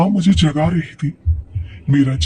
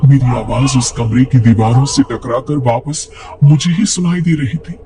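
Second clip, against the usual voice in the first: plain reading voice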